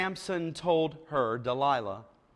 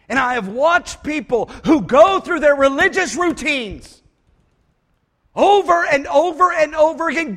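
preaching; 6 semitones higher, 10 dB louder than usual. Acted. Preaching at full force.

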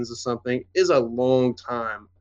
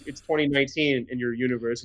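normal talking voice

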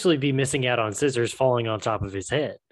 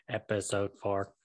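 flat, quieter robotic voice; 4 semitones lower, 8 dB quieter than usual. Pretend. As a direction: drone it like a cyborg. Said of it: "I sound more robotic".

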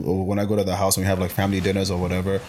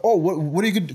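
higher-pitched mock-curious mom; imitating keen interest; 7 semitones higher than usual. Mom fussing over her gifted son, mock-interested. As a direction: play the suddenly interested mother.

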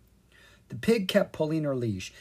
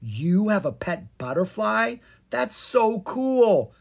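high, loud, slow amazement; much higher thrilled cry; excited cry; 9 semitones higher, 5 dB louder, slower than usual. A narrator's reading. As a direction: gush with wonder. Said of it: "Zed cried".

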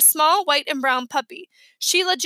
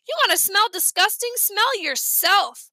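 taunting; 6 semitones higher than usual. Acted. Kids bickering insult.

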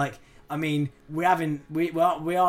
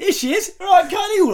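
hammed-up [name]; 7 dB louder than usual. [name] voiced snogging a mop.

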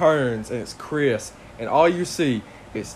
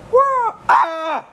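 high yelping imitated scream; mock scream; 4 dB louder than usual. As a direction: yelp it out like a scared kid. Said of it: "And all you hear is".

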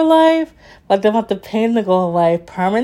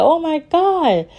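stunned disbelief; 8 semitones higher than usual. Retold or imitated. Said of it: "I said".

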